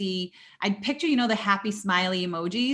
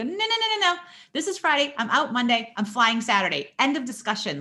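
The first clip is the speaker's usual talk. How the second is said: high-pitched, emphatic overruling; retold insistent refusal; 4 semitones higher than usual. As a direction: shut the doctor down, re-enacting it. Retold refusal of doctor's orders.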